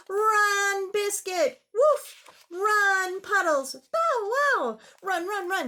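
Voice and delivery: loud high excited cheering; excited urging. Acted. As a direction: urge the puppies to run. Excited chase narration.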